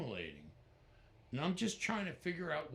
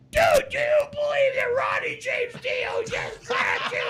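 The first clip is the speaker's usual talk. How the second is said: very high, loud shrieking disbelief; excited fanboy shout; 14 dB louder than usual. Retold or imitated. Matt shrieking at John after Ronnie talks to him. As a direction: scream it like a starstruck kid. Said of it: "goes like".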